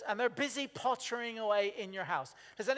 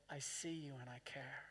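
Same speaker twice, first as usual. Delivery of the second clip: tender; 8 semitones lower, 15 dB quieter than usual. Retold or imitated. God's voice quoted tenderly.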